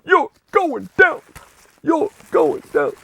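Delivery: very high, choppy wrestler impression; Ahmed Johnson. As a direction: mimic a wrestler's threat, high and word by word. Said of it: "Guys, remember Ahmed Johnson?".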